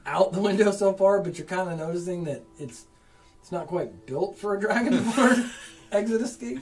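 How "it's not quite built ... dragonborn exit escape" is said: laughing. Real, not acted. GM cracks up describing escape.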